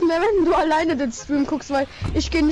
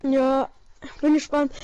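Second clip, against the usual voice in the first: ordinary talk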